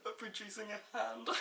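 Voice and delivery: helpless laughter breaking through the words; laughing hard. Real, not acted. Reviewer recalling Mae Young and the hand, laughing hard. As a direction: talk through a high, giggling laughing fit. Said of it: "haven't laughed so much in my life".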